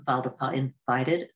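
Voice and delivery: ordinary delivery, nothing marked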